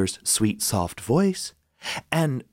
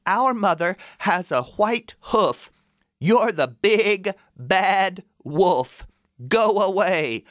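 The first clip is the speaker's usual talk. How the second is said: put-on high kid goat voices, scolding; high children's voices refusing the wolf; 9 semitones higher, slower than usual. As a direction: sound like bold little kids. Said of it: "one of the goats said".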